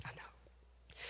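barely audible whispered reply; acted bereaved father, almost voiceless; hushed grief. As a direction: whisper it to yourself, very soft. Grieving father answers the teacher.

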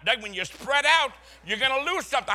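high, loud mock nagging wife; wife warning husband not to spread out, mock scolding. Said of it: "My wife says".

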